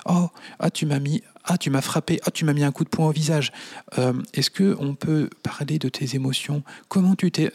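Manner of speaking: high, syrupy, overly sweet mum voice; sugary parent voice. Retold or imitated. Mocking a falsely gentle parent talking to Arthur, sugary. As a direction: coo like a saccharine parent. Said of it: "c'est pas parler comme ça".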